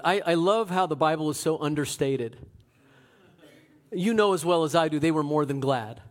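ordinary talk, nothing marked

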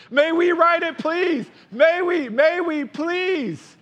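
put-on high, loud pleading kid voice; begging cubs. A narrator's reading. Cubs pleading with Papa for a ride, begging. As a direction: beg eagerly like small children.

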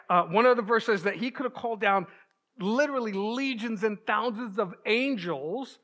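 normal talking voice

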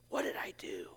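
hushed, fretful whisper to oneself; anxious whisper. Acted. Worried recipient asking himself after a text, anxious whisper. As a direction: whisper it as you search your memory.

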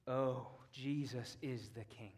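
hushed reverence. Real, not acted. Hushed exclamation after pause.

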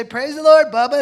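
mom's high, loud call of Praise the Lord to Bubba; mom calling the dog. Retold or imitated. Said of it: "and she'd say".

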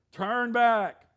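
loud, driving call; emphatic call to repent. Shown as a demonstration. Pastor calling the congregation to turn back, loud. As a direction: urge the crowd to turn back. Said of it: "I'll say turn back".

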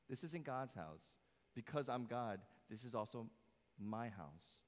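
ordinary talk

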